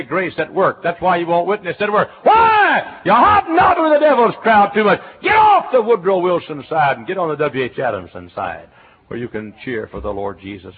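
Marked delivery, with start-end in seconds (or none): 2.2-8.7 very high, loud fiery rebuke; preaching shout. Real, not acted. Preacher rebuking his flock for running with the wrong crowd, shouting. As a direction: thunder it at the congregation.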